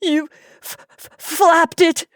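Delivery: wife sobbing out her accusation to her husband. Acted. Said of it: "Sobs shook her".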